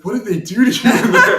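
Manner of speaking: loud, high-pitched incredulous question; alarmed disbelief. Retold or imitated. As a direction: voice the shocked friend, climbing into a laugh. Friend's alarmed reaction retold.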